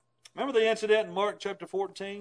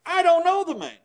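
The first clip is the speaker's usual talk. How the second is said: cursing denial; 8 semitones higher, 8 dB louder than usual. Retold or imitated. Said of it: "he began to curse and swear".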